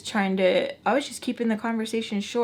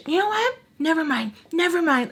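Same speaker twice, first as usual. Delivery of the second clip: high, raised-voice huffy dismissal; mad grandma; 8 semitones higher, 4 dB louder than usual. Retold or imitated. Grandma gives up on asking granddaughter, mock-angry and high. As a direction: imitate a grandma snapping in a high voice. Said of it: "she got so mad".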